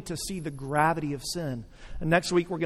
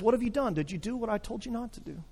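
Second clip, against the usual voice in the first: softer, patient father's questions; gentle coaxing; 4 semitones higher, 6 dB quieter, faster than usual. Acted. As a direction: draw the truth out kindly. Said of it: "coaxing them out of their shame".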